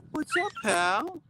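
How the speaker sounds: very high, slow cooing. Real, not acted. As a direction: coo it to the puppy. Talking to the dog.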